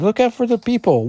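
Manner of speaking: high mock-panicked female voice; alarmed warning. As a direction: call a warning in a squeaky falsetto. Megan warns while falling.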